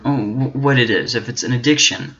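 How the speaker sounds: usual speaking voice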